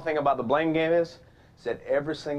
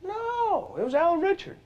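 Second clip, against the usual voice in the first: put-on much higher, indignant old neighbor; neighbor's correction; slower than usual. Neighbor correcting the dad at the door, high indignant voice.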